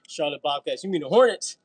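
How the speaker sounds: loud, laughing correction. Real, not acted. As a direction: call out the mistake loudly.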